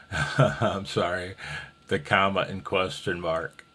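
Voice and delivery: ordinary talk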